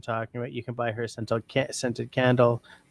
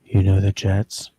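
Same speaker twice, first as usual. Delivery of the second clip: loud, slow, lower voice; louder, deliberate repeat; 5 semitones lower, 6 dB louder than usual. Real, not acted. Repeating for a friend who can barely hear, loud and slow. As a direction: spell it out loudly and slowly for poor hearing. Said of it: "I can barely hear you".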